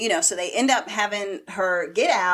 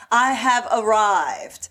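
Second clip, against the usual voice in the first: grand entrance; 4 dB louder, much slower than usual. Retold or imitated. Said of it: "she comes down the stairs".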